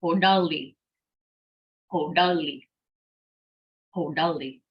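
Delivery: "Ho, da, li" run together as one word; whole-word model of "hodali", repeated after pauses; all together. Shown as a demonstration. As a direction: join "ho", "da", "li" without gaps. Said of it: "So all together".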